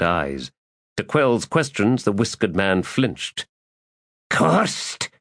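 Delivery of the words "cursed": rasp. A narrator's reading. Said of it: "he said in a rasp".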